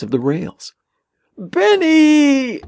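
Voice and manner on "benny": very high, long screamed name; terrified scream. A narrator's reading. Tommy seeing Ben lie on the tracks, screaming. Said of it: "Tommy screamed".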